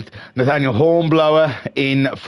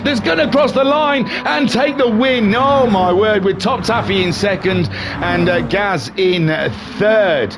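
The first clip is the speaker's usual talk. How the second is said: excited race commentary; 14 semitones higher than usual. Acted. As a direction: call the finish like a horse-race announcer. Live race call, high excited.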